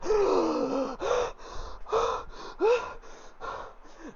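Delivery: sharp high gasp torn out of a trance; big startled inhale, high. Acted. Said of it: "she's like".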